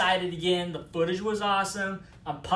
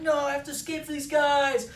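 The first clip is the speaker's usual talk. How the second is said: higher-pitched hero in a hurry; pitched-up, hammed-up haste; mock urgency; 6 semitones higher than usual. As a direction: imitate an urgent refusal while on the run. Fleeing hero in retold chase.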